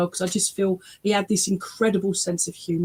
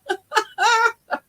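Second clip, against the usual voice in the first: real high-pitched laughter; high giggling laugh; 14 semitones higher than usual. Real, not acted. Medium amused by the silky hair joke, laughing.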